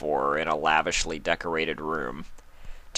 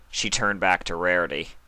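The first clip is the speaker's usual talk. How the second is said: ordinary delivery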